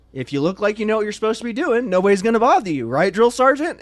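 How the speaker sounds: high, loud and sure of himself; cocky explanation. Retold or imitated. Recruit cockily explaining himself to the drill sergeant, high and loud. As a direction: lay it out with swagger.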